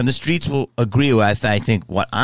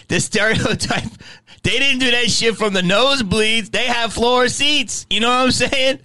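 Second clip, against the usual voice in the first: excited laughing shout; 10 semitones higher than usual. Real, not acted. Punchline about floor seats.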